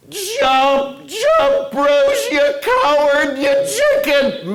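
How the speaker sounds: high loud jeering calls from below; mock taunting yell. Friends in the boat taunting Bruce, high loud jeering yell. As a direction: holler up at him to jump. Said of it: "yell up at me".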